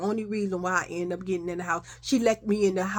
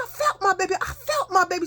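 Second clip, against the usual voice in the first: high, emotional insistence; acted distraught mother; 10 semitones higher than usual. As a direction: cry it out as a mother who sensed trouble.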